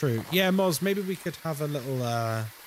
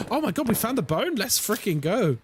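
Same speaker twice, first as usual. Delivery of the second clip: excited gasp; 7 semitones higher than usual. Real, not acted. Finding the bone in game.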